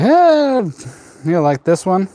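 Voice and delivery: high bright "hey" from a seller; cheerful casual offer; friendly offer. Retold or imitated. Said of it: "He's like".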